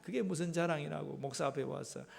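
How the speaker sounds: ordinary delivery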